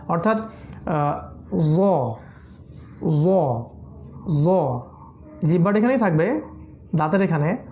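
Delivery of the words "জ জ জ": জ said with slightly rounded lips; rounded letter sound. Shown as a demonstration. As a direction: round the mouth a little on জ. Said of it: "মুখটা একটু গোল করতে হবে".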